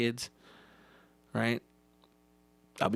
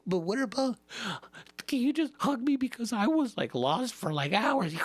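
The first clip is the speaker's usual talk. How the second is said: put-on pleading kid; 9 semitones higher than usual.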